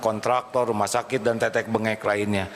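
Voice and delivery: usual speaking voice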